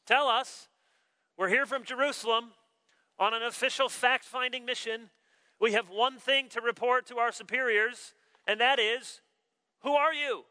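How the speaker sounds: official questioning. Acted. Envoys from Jerusalem demand John's identity, official questioning. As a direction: press for an answer like an envoy.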